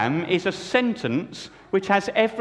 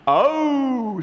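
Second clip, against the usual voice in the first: men's amazed oh; 8 semitones higher than usual. Acted. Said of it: "said the men".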